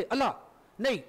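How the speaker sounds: ordinary talk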